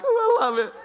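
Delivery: very high little squeal of pleasure; teasing falsetto delight; mock delight. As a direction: swoon it in a silly high voice. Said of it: "I'd be like".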